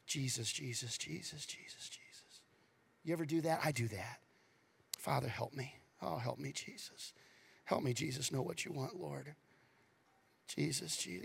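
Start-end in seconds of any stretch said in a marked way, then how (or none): 0.1-2.4 softly repeated name, fading to a whisper; fading prayerful murmur. Shown as a demonstration. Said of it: "you just say it like this". 5.0-11.2 soft pleading prayer. Shown as a demonstration. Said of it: "you just say it like this".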